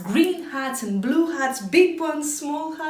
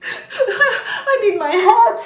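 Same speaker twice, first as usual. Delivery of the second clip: higher, louder wail with sobbing; mock crying; 9 semitones higher, 11 dB louder than usual. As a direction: wail like a broken man. Man breaks down crying.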